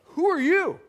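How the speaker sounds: high, loud startled cry with a laugh; startled disbelief. Retold or imitated. Staff reacting to the pastor's bold claim, startled. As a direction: gasp it out in amused shock. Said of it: "My staff looked at me like".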